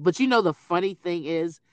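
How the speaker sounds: normal talking voice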